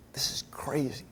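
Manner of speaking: hushed, breathy aside; a preacher letting the congregation in on a secret, whispering; awed whisper. Real, not acted. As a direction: whisper it in wonder.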